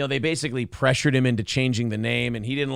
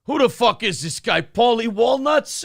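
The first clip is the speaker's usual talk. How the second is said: fake gangster voice; 9 semitones higher, 3 dB louder than usual. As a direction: ham up a Paulie Walnuts mobster. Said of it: "your fucking fake gangster voice".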